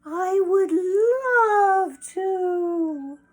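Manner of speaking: high, loud, very drawn-out delight; gushing acceptance. Acted. Hen accepting the fox's invitation, gushing and drawn out. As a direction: stretch it out in a high, gushing sing-out.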